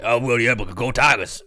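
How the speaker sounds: mock Louisiana football coach; hammed-up Southern coach cheering his team; Coach Orgeron. Host riffing on an LSU coach, mock Southern impression. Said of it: "You know Coach Orgeron from L S U?".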